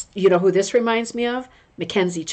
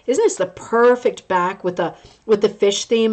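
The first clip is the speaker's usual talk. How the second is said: usual speaking voice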